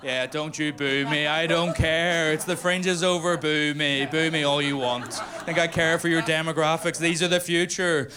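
high-pitched, hammed-up threat to the crowd; mock menace. Comedian mock-threatening the young crowd, high hammed-up menace. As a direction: pretend to scold the young ones, pitch way up.

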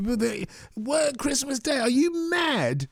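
high, slow, stunned disbelief; incredulous. Pretend. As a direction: act scandalised at the very idea. Scoffing at Christmas work.

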